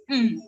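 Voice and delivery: "Mm" hummed alone as a phoneme; isolated M sound. Shown as a demonstration. Teacher asking what the phoneme means, "Mm" hummed alone. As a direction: sound out a bare M, "Mm". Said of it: "y la pronuncio".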